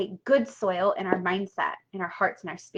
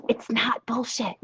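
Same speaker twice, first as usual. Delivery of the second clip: usual speaking voice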